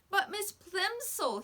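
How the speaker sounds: put-on high, slow, urgent boy's plea; begging loud whisper. A narrator's reading. Boy begs teacher during the test, high and slow. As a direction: beg her to listen. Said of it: "he begged in a loud whisper".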